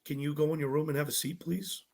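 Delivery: soft, deadpan official request; quiet, sober, poker-faced ask; straight-faced producer. Retold or imitated. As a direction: imitate a producer giving nothing away. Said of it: "all straight face".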